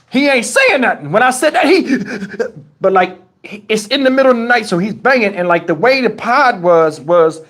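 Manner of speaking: normal talking voice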